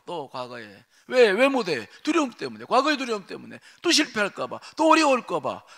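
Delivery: high, loud fervent preaching; preaching at full force. Acted. Pastor urging the flock to step out, fervent preaching. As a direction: drive it home to the congregation.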